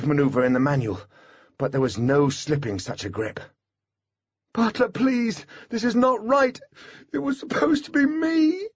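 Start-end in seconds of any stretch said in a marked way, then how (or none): 4.5-8.7 high, tearful plea; anguished, near-crying protest; desperate pleading. A narrator's reading. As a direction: beg to be let go, voice pitched way up. Held back, Holly pleads with Butler.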